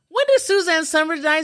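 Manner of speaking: high, loud imitation of a persistent woman; loud nosy question. Patient asking the physical therapist, loud high imitation. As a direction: put it loudly to the room.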